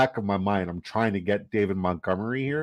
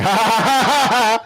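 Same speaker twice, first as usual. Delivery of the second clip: higher, louder put-on voice of a laughing friend; Quasi impression; 14 semitones higher, 8 dB louder than usual. Streamer imitates his friend Quasi laughing at the draft, in a higher, louder put-on voice. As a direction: mimic your buddy mid-laugh. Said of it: "He's going".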